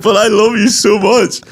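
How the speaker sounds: mock pleading fan. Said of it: "and I was like".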